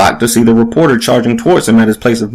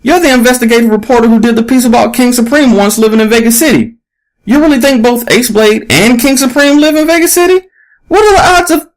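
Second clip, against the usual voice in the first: much higher, rushed fan voice; eager young fan; 12 semitones higher than usual. A narrator's reading. Young man excitedly quizzing the reporter, eager high fan voice. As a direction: gush over her like a starstruck kid.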